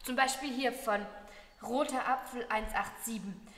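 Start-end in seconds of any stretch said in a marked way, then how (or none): none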